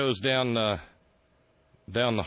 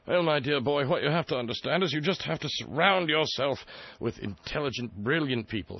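mock Prince Charles; 7 semitones higher than usual. Prince Charles advising the president, in a mock royal voice. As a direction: play the condescending royal uncle. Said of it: "And Prince Chuck said to him".

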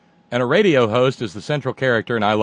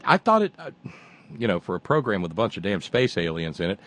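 ordinary talk

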